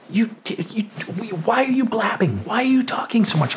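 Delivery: high, hushed scolding assistant; a hissed telling-off from the assistant; retold scolding. Assistant scolding her client in a hushed voice. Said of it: "my lawyer's assistant is yelling at me".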